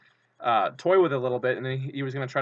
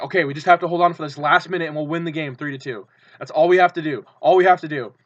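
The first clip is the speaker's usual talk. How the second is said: tense urging; 3 semitones higher, 7 dB louder than usual. Retold or imitated. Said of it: "I was like".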